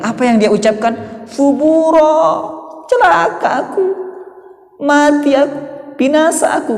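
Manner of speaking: very high, wailing cry of doom; desperate shout. Acted. The condemned man crying out on judgment day, high wail. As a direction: cry out like a damned soul, pitch soaring. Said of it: "Akan berteriak".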